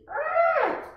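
high, loud monster roar; bare-teeth growl; fierce growl. Acted. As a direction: roar like an angry little monster. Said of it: "I make fierce noises".

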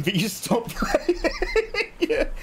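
slow words breaking into real laughter; laughing. Real, not acted. Streamer joking with chat about mean banter, laughing. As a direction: crack up while finishing the sentence.

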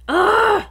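high, loud "ugh" of frustration; loud exasperated groan. Real, not acted. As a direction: groan out loud, totally fed up. Fed up with feeding attempts.

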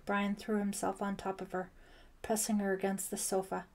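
usual speaking voice

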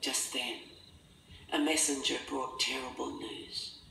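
ordinary delivery

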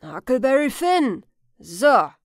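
put-on loud old man's questioning echo; skeptical repeat. Old man weighing the boy's name, loud skepticism. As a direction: throw the name back with a falling, doubtful tone.

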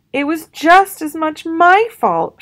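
high, loud and slow, insisting; shouting. A narrator's reading. Sister shouting over her brother to Mama, loud and slow. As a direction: call it out, claiming her share of blame. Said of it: "shouted sister".

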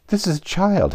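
high-pitched eager reply. A narrator's reading. Heya introduces Alice to the Unicorn, eagerly. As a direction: present it eagerly, voice lifted high. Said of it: "Heya replied eagerly".

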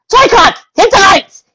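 high, loud shouted order; calling out. Acted. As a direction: bark the order across the room.

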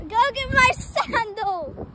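normal talking voice

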